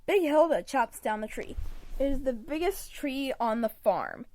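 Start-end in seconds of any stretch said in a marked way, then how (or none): none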